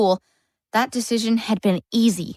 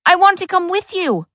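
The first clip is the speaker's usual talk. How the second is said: eager plea; 9 semitones higher, 4 dB louder than usual. A narrator's reading. Boy pleads to go along.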